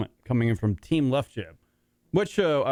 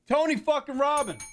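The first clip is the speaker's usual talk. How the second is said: incredulous exclamation; 14 semitones higher than usual. Real, not acted. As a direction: exclaim it way up high. Exclaims at news about his favorite guru.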